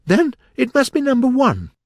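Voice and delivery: high, loud comeback; triumphant retort. A narrator's reading. Alice out-arguing the King, high and triumphant.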